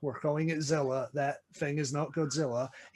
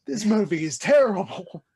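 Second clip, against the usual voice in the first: louder, higher exasperated verdict; exasperated outburst; 6 semitones higher, 7 dB louder than usual. Real, not acted. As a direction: let the frustration burst out. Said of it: "how much I hate this movie".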